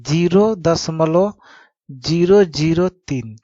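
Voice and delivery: "ज़ीरो ज़ीरो तीन" read slowly, each digit separate; slow, deliberate "ज़ीरो दशमलव ज़ीरो ज़ीरो तीन". Shown as a demonstration. Teacher shows students how to read the decimal, slowly digit by digit. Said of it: "इसको क्या पढ़ते हैं".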